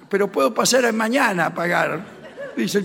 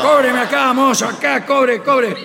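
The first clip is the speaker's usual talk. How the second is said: loud, higher, bossy demand to the waiter; mock angry shout; 5 semitones higher, 7 dB louder than usual. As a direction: bark at the waiter, playing offended. Angry father demanding to pay. (el padre ofendido le grita al mozo que le cobre)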